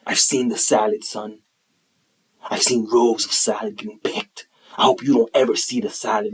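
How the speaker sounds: low, grave war-veteran delivery with long pauses; Jocko Willink. Retold or imitated. Stoic dad answering his son about salad, low and grave. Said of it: "he talks like this".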